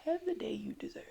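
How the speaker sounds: high voiceless whisper like casting a curse; hex-like whisper. Retold or imitated. Daughter imitating mom hexing someone who crossed her, whispered. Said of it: "like she put a hex on you".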